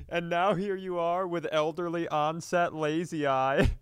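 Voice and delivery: normal talking voice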